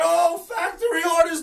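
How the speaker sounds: high, loud sarcastic mimicry; mocking imitation. Host mocks pundits on factory orders, high mock alarm. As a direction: scoff at the pundits in their own words. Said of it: "They're talking about".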